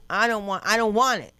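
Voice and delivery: loud, high comic protest; hammed-up insistent refusal; emphatic refusal. Ranting against anything English.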